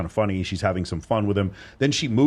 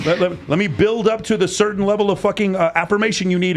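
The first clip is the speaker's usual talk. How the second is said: sarcastic mock; 8 semitones higher than usual. Mocking a needy partner.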